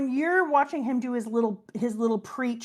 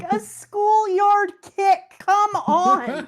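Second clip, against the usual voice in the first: laughing delight; 9 semitones higher, 4 dB louder than usual. Real, not acted. Delighted retelling of the kick.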